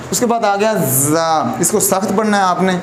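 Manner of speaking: ordinary delivery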